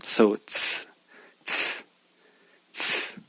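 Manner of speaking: ts whispered crisply, tongue tip behind the teeth; ts sound drilled. Shown as a demonstration. Teacher drilling the ts sound, whispered softly. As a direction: hiss out ts three times, unvoiced. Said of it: "is the same as the C sound".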